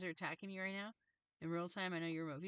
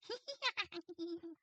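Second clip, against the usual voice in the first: high-pitched imitated hyena cackle; quick, high mock animal giggle; hyena laugh; 12 semitones higher, 3 dB louder than usual. Beings laughing like hyenas, high snarky cackle. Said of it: "They're like hyenas that are like".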